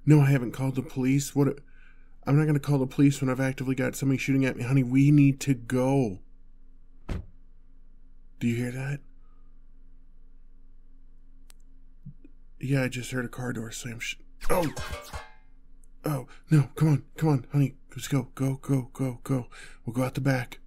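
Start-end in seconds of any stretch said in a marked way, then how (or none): 2.3-6.2 frantic insistence. Acted. Refusing to call police while shot at, frantic insistence. Arguing while someone is shooting. 14.5-20.6 panicked urging. Acted. Urging honey out the back as attackers arrive, panicked urging.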